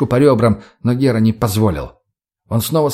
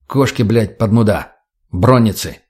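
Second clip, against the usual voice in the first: usual speaking voice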